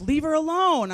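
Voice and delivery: loud, high mock shout; exasperated yell. Dad tells mother to stop poking baby, exasperated yell. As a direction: act out a fed-up dad yelling.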